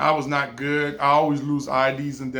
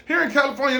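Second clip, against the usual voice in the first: ordinary talk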